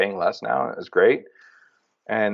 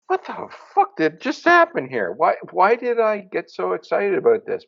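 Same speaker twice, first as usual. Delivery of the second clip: bewildered disbelief; 9 semitones higher than usual. Retold or imitated. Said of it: "I'm like".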